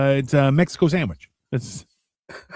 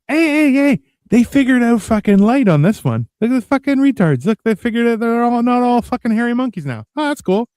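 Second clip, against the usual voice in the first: high, amused voice sneering at humans; mocking alien; 8 semitones higher than usual. Passing aliens mocking humans, high amused sneer. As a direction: jeer down at the hairy monkeys. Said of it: "And then they're like".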